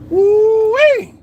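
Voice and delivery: loud, high mocking whoop; high whooped "woo-wee". Reacting to the Y2K money panic, mocking whoop.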